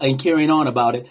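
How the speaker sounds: ordinary delivery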